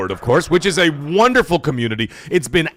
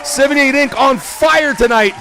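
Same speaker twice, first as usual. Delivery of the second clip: 1 hyped announcement; 11 semitones higher, slower than usual. Real, not acted.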